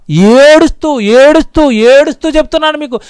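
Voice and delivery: preacher near tears over lost souls; tearful pleading. Acted. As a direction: let the voice almost break. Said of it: "ఏడుపు వచ్చేస్తుంది".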